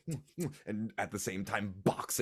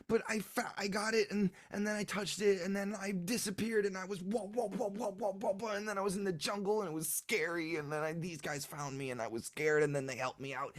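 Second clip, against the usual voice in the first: put-on high, breathless kid chatter; little boy voice; 12 semitones higher, faster than usual. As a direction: tumble the story out like a child. Child tells his adventure.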